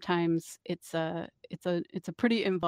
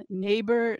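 ordinary delivery

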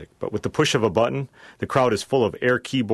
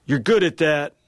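lightly acted drunk, voice pitched higher; slurred drunk; 6 semitones higher, 3 dB louder than usual. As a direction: slur it a little, like a tipsy wedding guest. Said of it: "then slurs".

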